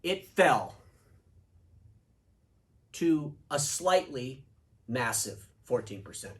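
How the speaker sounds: drawn-out mock euphemism; mock softened version. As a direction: stall on slightly to soften the blow. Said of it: "This is where we would say something like".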